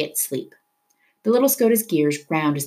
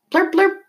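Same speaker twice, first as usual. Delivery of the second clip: high, sharp siren blip in the voice; imitated siren blurp; 4 dB louder than usual. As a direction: chirp it out like a police car's siren. Narrator imitates police siren.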